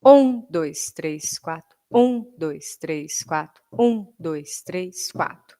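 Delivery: accent the one, let the rest fall away; quadruple count. Shown as a demonstration. Teacher counts four beats, accenting the downbeat. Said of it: "Quaternário".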